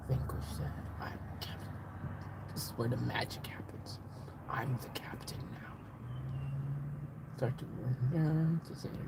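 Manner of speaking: whispering. Acted. Streamer declaring himself the captain now, whispering. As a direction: whisper it under your breath. Whispered film line and asides.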